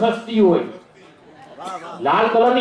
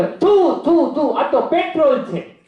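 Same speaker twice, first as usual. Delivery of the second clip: higher, slow mock spitting; spitting in disgust; 6 semitones higher, much slower than usual. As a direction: spit out the petrol in disgust, drawn out. Spitting out petrol. (ધીમે, ઊંચા સૂરે થૂંકવાનો અભિનય)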